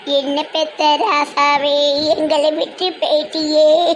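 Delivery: slow, drawn-out dirge wail; mock funeral wail. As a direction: stretch every word like an old village lament. Mourning lament for the dead. (இழுத்து ஒப்பாரி வைக்கும் குரல்)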